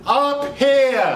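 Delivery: mock yell at a deaf crowd; hammed-up shout; shouted for the hard of hearing. Shown as a demonstration. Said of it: "for the hard of hearing".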